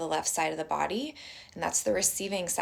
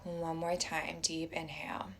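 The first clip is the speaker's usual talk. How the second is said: soft, unhurried meditation voice with long pauses; guided meditation; 5 dB quieter than usual. Acted. Teacher inviting everyone to close their eyes and breathe, guided meditation.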